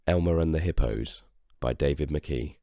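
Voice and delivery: ordinary delivery, nothing marked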